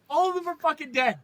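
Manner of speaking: fast, high-pitched comic indignation; outraged rant. Pretend. Mock argument on Aang's behalf.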